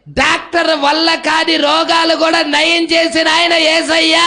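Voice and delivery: loud, high fervent preaching; preaching at full force. Acted. Preacher rousing the congregation, loud and fervent. Preaching about faith over doctors.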